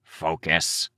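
understated growled command; a touch of beastly growl; creature growl. A narrator's reading. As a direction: growl it lightly at a distracted partner. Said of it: "Cryptid growled".

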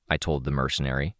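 ordinary delivery

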